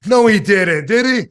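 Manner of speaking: high, loud disbelieving yell; an old boss yelling at a young wrestler backstage; retold yell. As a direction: bellow it in shocked disbelief. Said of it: "Gorilla Monsoon was yelling".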